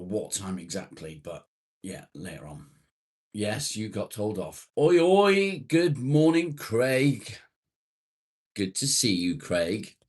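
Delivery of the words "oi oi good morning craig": high, loud oi-oi hello; calling out greeting. Real, not acted. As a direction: holler a cheery hail, starting at the top and easing down. Hailing a viewer joining the stream.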